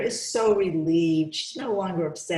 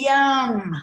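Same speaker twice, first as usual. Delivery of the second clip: delighted yum; 7 semitones higher, 7 dB louder than usual. Acted. Derek delighted by his lunch.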